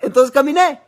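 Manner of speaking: high, loud punchline; triumphant punchline; joyful reveal. Retold or imitated. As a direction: deliver the miracle with delight. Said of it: "ella dijo".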